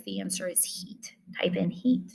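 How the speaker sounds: hushed aside to the class; a quick conspiratorial whisper. Real, not acted. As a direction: whisper the answer like a secret. Teacher whispers answer aside.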